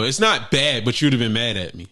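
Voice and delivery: ordinary talk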